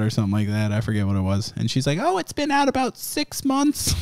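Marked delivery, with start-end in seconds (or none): 2.0-3.9 put-on high, soft old grandma voice; old lady voice. Grandma answering the cop in a high old-lady voice. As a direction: play the sweet old lady, pitched way up.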